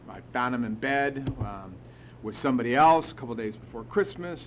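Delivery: ordinary delivery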